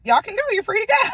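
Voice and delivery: high, loud, hurried send-off; hammed-up nervous eagerness; eager dismissal. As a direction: get rid of them fast and cheerfully. Lawyer hastily dismisses the sisters.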